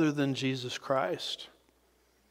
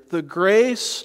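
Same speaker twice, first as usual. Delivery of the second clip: grace raised and louder with a rising end; stress on grace; 4 semitones higher, 4 dB louder than usual. Shown as a demonstration. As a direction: point at grace and lift it. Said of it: "you'll notice what it says here".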